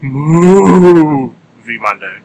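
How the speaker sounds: Movie stretched into a loud, long moo; cow's moo. Pretend. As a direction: moo the first syllable like a cow. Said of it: "Perhaps that's how a cow would say it".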